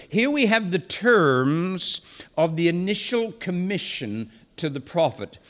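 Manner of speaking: ordinary delivery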